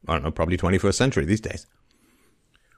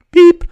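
high, loud machine beep; imitated beep; 13 dB louder than usual. Marking the next phone message, a piercing imitated beep.

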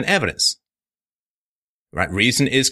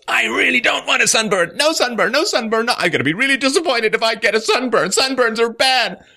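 mock pleading shout; 12 semitones higher than usual. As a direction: beg the sun, hammed-up. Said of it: "And you say".

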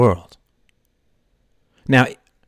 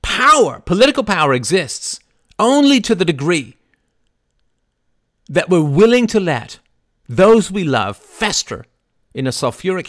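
much higher and louder, impassioned; fiery preaching; 9 semitones higher, 5 dB louder than usual. Real, not acted. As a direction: hammer home the warning. Impassioned speech.

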